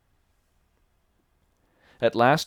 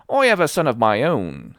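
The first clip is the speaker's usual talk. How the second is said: put-on loud, wheedling old sea dog; sly old sailor; 5 semitones higher, 8 dB louder than usual. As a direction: coax the boy like a fawning pirate.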